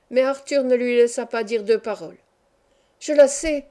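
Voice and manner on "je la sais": slightly higher, louder cry of success; eager exclamation. A narrator's reading. Son to his mother about the fable, exclaiming. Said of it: "s'écria-t-il".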